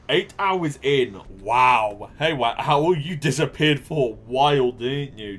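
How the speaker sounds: loud, high hello to a returning viewer; light up at a familiar face; excited greeting. Real, not acted. Streamer greeting a chatter who came back, excited.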